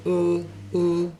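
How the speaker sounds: "L" as a dark "ull" sound. Shown as a demonstration. Teacher demonstrates the end-of-word sound, dark L. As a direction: sound a bare dark "L", tongue back. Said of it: "will always be a dark L".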